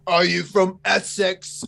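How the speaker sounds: high, slow mock Essex accent; exaggerated Essex greeting; put-on British accent. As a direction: lay on a stretched-out British accent. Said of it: "why am I putting an accent on".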